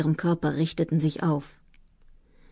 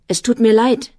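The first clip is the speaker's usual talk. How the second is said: higher, much louder and breathless with agitation; excited apology; 6 semitones higher, 8 dB louder than usual. A narrator's reading. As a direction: blurt it out, flustered and louder. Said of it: "sagte Atta aufgeregt".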